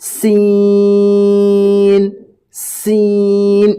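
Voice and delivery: সিন said lightly and long; a light held সিন; light small sin. Shown as a demonstration. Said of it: "এটি হচ্ছে ছোট সিন সামান্য পরিমাণ". (হালকা করে লম্বা সিন)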